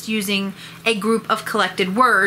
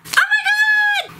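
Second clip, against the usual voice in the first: very high shriek; shrieking oh my god. Shown as a demonstration. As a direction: shriek it in disbelief. Demo series of OMG variants.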